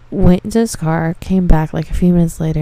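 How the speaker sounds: ordinary talk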